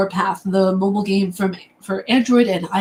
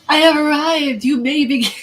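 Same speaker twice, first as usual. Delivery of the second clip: mock grand entrance; 6 semitones higher than usual. Streamer announcing an arrival to chat, mock grand. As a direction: lightly play the self-important arrival.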